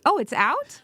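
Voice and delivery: high-pitched surprised question; imitating the daughter's relief. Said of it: "and it's like".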